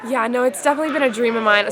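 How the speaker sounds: lost voice, rough and strained; speaking with a worn-out hoarse voice. Real, not acted. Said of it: "Sorry, I lost my voice".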